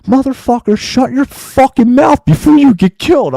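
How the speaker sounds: high-pitched angry yell, re-enacted; retold yelling. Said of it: "I start yelling at this guy".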